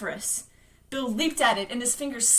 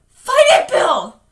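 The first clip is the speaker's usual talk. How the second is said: very high, loud desperate scream; 6 semitones higher, 15 dB louder, slower than usual. Acted. Beverly begging Bill to fight, screaming. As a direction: scream to save him. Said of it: "Beverly was screaming".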